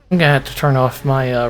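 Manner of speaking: ordinary talk, nothing marked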